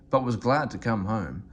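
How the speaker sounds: plain reading voice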